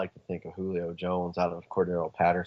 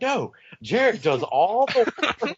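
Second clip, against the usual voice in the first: loud, very high, drawn-out protest; exasperated retold protest; 13 semitones higher, 5 dB louder than usual. Husband arguing back to wife about who scored, loud mock protest.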